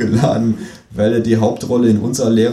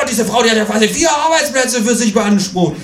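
high, outraged shouting; indignant shout; 10 semitones higher than usual. Acted. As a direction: rant it with outrage. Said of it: "ruft er".

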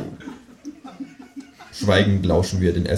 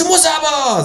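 very high, squabbling creature voice; insistent kangaroo. A narrator's reading. Kangaroo squabbling in the dark, insisting high. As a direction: insist in a high childish squeal.

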